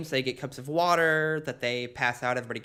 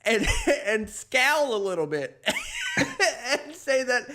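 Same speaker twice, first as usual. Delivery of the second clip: laughing through it; 10 semitones higher than usual. Real, not acted. Still laughing while telling.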